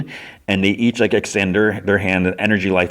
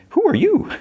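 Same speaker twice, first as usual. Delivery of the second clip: very high, sudden question; startled figure's voice. Retold or imitated. Dream Finn confronts Fiona, high-pitched mock challenge. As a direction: mimic a startled dream character.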